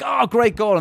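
high, pleased cry at a goal; bright high football cheer; mock cheer. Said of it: "I'm like".

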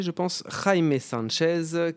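usual speaking voice

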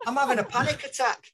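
high, loud panicked cry; hammed-up alarm, pitched right up; mock panic. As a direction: wail it like a panicking roommate. Roommate panics in retold scene.

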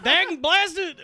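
high, loud cranky prospector; old prospector. Pretend. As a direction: cuss like an old miner. Prospector bit continues.

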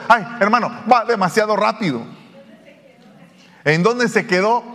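lightly voiced fed-up churchgoer; mock complaining listener. Listener complaining about fast preaching.

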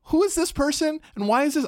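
high, bewildered voice for the friend; a high, puzzled voice for a confused friend; confused friend quote. Retold or imitated. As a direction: play the baffled friend asking questions. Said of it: "She's like".

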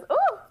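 high, loud startled "Ooh"; squealed "Ooh" from the elephant wife; high yelp. Retold or imitated. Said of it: "And she goes".

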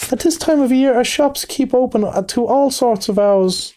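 high little voice, politely informative; respectful field mouse. A narrator's reading. Field mouse telling the Rat about opening hours, respectful. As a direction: explain deferentially in a piping voice. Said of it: "replied the Field Mouse respectfully".

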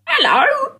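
very high Cockney "Hello!"; street urchin voice. Retold or imitated. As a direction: shout it like an Oliver Twist urchin. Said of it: "do an impression of my mom".

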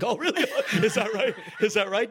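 high-pitched mock astonishment; sarcastic disbelief. Sarcastic retort to doctor's diagnosis.

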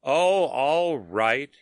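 very slow, tired sigh of a reply; weary. A narrator's reading. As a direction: drag it out, bored and worn. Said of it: "he said wearily".

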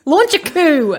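laughing high shout; mock outrage. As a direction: yell it like a pompous critic, laughing.

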